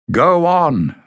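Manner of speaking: loud, high call across the bridge. A narrator's reading. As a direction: call out to her from afar. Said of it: "Bada called".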